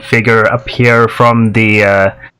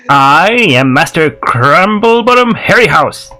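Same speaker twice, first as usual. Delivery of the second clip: higher, sing-song self-important introduction; Master Crumblebottom Harryhouse; 5 semitones higher than usual. Acted. Gnome introducing himself to a talking cloud, higher sharp voice. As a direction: announce yourself in a raised gnome voice. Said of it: "you hear this sharp voice call out".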